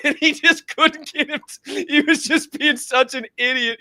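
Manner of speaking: high-pitched talk through laughter; cracking up. Real, not acted. Fan retelling Alex Jones clip, cracking up. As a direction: let laughter push the words way up high.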